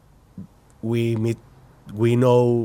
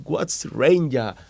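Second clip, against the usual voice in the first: puzzled Queenslander imitation; 4 semitones higher, slower than usual. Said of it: "And then you said".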